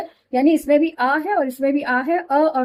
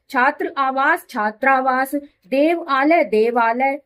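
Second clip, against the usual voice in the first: slow split; slower than usual. Shown as a demonstration. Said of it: "teacher demonstrates joining words".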